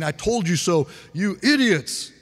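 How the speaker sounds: taunting, pitch jumping up on the insult; hammed-up I-told-you-so contempt; mock scolding. Preacher parodying a gloating Jesus who never was, mock scolding. Acting out what Jesus didn't say.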